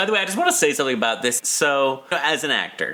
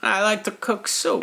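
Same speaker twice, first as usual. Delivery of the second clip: higher, lightly voiced sweet homebody; mock actress; 9 semitones higher than usual. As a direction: lightly mimic a contented homebody. Mocking the actress's quote.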